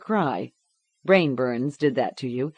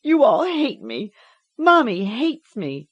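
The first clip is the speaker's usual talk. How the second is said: put-on despairing child; 8 semitones higher, 5 dB louder than usual. A narrator's reading. Girl despairs.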